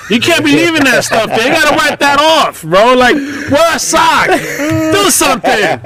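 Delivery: high-pitched, exasperated telling-off; exasperated dad lecture. Retold or imitated. Retold scolding of his son.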